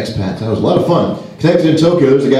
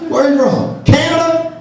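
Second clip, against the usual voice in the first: a high, shouty American naming a landmark; dopey American tourist; 12 semitones higher than usual. Retold or imitated. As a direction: blurt it out like a clueless tourist. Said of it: "what Americans like myself must sound like".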